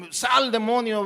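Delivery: a loud, high rebuke of the demon; mock commanding shout. Preacher acting out his own failed exorcism, mock commanding shout. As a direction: bark the order at the demon, full of show.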